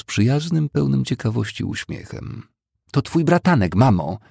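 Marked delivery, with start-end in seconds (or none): 2.9-4.2 snapping at her mother, higher and louder; sharp tone. A narrator's reading. A sharp, exasperated retort. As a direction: Ashton snaps at her confused mother, sharp and impatient. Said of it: "Ton jej głosu był tak ostry".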